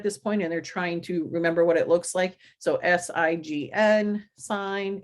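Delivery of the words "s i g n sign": slow, evenly spaced letters, then the word; letter-by-letter spelling. Shown as a demonstration. Teacher showing the tapping routine, slow letter-by-letter spelling. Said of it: "they say each letter".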